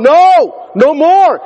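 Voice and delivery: imitating a people crying out enough; desperate cry. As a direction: cry out at the breaking point. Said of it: "you're gonna go".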